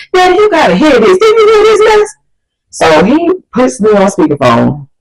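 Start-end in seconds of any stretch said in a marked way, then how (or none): 0.1-2.1 put-on very high, indignant boy; hammed-up outraged son; calling Dad over. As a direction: call out to Dad in a high, worked-up voice. Boyfriend calls his father over.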